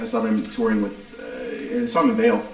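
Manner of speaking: normal talking voice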